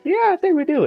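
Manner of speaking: high-pitched, lightly acted tearful reply; mock tearful. Girl starts crying as she answers him, mock tearful. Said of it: "she starts crying".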